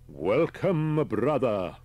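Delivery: loud, high, ringing greeting; booming welcome. Acted. Cult leader greeting a stranger who stumbled in, booming welcome. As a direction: hail him like a zealous preacher.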